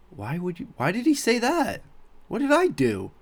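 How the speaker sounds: high-pitched, wounded disbelief; bewildered. Retold or imitated. Employee left standing after coworker's insult, bewildered. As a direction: plead for an explanation in a high voice.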